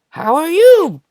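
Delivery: very high, loud cartoon squeal; Jar Jar impression. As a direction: pipe up in a silly falsetto greeting. Jar Jar walks out, greets.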